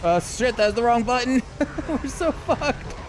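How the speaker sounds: pressing the wrong button in panic; panicking gamer. Real, not acted. Wrong button mid fight.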